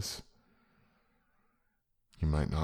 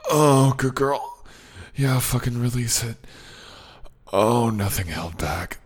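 ecstatic shouting; 8 semitones higher, 10 dB louder than usual. Acted. Ecstatic loud praise.

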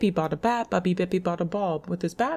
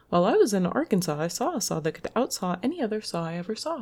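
fast reading; faster than usual. Shown as a demonstration.

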